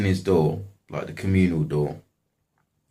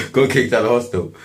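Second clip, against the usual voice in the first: real laugh carrying into higher, louder words; amused, laughing; 7 semitones higher, 8 dB louder than usual. Real, not acted. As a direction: say it through laughter, pitched up. Laughs while telling own eviction.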